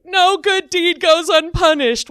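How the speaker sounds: high, loud, fast comic lament; mock anguished outcry. Teller fleeing into his car, mock-anguished high outcry. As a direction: cry it out in hammed-up exasperation.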